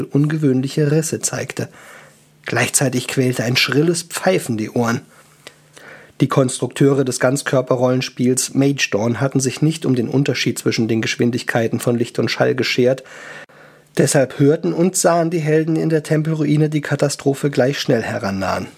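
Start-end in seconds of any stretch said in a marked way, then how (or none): none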